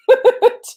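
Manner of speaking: quick high burst of laughter. Real, not acted. Laughing at herself while telling friends: high laugh. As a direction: break up laughing mid-thought.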